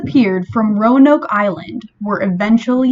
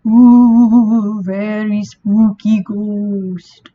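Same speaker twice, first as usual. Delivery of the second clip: slow, drawn-out spooky ghost moan; ghostly wail; much slower than usual. Pretend. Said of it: "I have to make a ghost sound".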